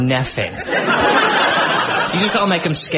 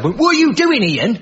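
much higher, louder Birmingham accent, imitating a dad; 12 semitones higher, 4 dB louder than usual. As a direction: mimic a Birmingham dad scolding his kid, pitched high and loud. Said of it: "Or with that accent".

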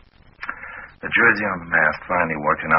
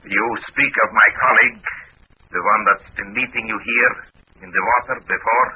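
a Russian agent, a little higher; Russian accent; 5 semitones higher than usual. Acted. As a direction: stay in the Soviet agent role. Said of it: "in my best Russian accent".

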